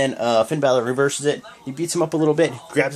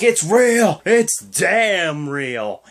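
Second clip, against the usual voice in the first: Kurt Angle catchphrase; 2 semitones higher, 3 dB louder, much slower than usual. Retold or imitated. Kurt Angle declaring it's real, hammed-up and drawn out. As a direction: mimic the wrestling announcer's catchphrase, stretching the second line out. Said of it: "he's like".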